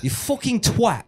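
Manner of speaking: high-pitched mock English accent; Englishman's insult. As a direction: swear like an indignant Englishman. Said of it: "if an Englishman calls you a twat".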